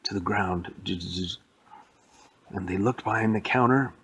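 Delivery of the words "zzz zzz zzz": short repeated electrical zaps; imitated electric buzz. Robot short-circuited by a battery, soft buzzing zaps. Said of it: "and she went".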